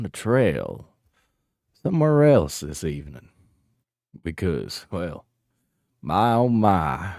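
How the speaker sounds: put-on mosey along in a big Texas cowboy accent. Streamer signing off to chat in a Texas accent. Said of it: "I'll do the Texas accent".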